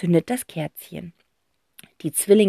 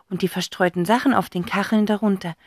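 plain reading voice

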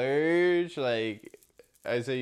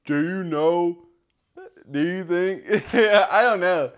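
put-on country accent; 6 semitones higher, 5 dB louder than usual. Asking his friend the card question in a put-on country accent. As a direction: ham up a Southern country voice, breaking into laughs. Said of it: "My country accent".

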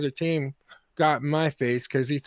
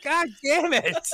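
high, drawn-out real exasperation; fed-up, stretched-out curse; exasperated; 9 semitones higher, slower than usual. Real, not acted. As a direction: protest the teasing, slow and high-pitched. Exasperated at hosts' teasing.

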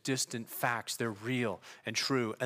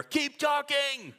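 imitated approving yell; 10 semitones higher than usual. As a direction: holler it out like an eager crowd member. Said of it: "they'll yell from anywhere".

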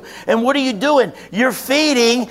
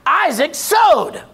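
usual speaking voice

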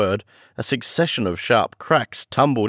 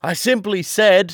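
higher, raised voice; shouting; 11 semitones higher, 4 dB louder than usual. A narrator's reading. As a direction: shout to be heard. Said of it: "shouted John".

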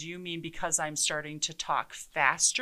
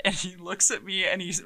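normal talking voice

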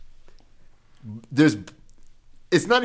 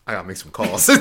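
low, quiet, dismissive; low flat mumble; 8 semitones lower, 4 dB quieter, faster than usual. Retold or imitated. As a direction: mutter it low and slip away. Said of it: "And he was like".

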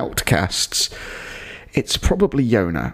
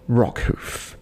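deflated deadpan. Real, not acted. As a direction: let the name sink with weary resignation. Deadpan naming of the character.

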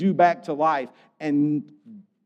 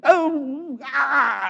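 Grinch groan; 12 semitones higher, 3 dB louder than usual. Retold or imitated. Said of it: "the one with Jim Carrey. It's like".

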